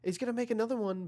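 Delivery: quick, climbing call as he makes men miss; excited play-by-play. Real, not acted. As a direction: ride the excitement of the run. Live call of a juking run.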